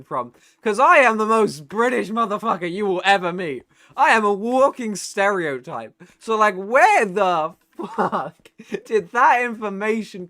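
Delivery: loud, high-pitched incredulous outburst; flabbergasted rant. Retold or imitated. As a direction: protest in loud disbelief, pitch pushed way up. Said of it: "I was just flabbergasted".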